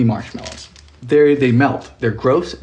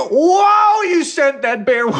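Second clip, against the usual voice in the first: startled shout; 11 semitones higher, 4 dB louder, much slower than usual. Real, not acted. Startled by a bear on screen.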